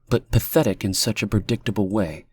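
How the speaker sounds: ordinary delivery, nothing marked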